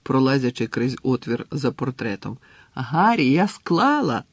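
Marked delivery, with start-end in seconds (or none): 2.7-4.2 high, raised exclamation of triumph; jubilant, lifted voice; excited announcement. A narrator's reading. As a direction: burst out with the good news. Exclaims news of passing exam.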